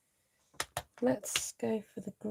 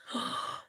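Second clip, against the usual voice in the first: soft gasp; 8 dB quieter than usual. Real, not acted. Sympathy for injured viewer.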